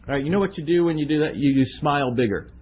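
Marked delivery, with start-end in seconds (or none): none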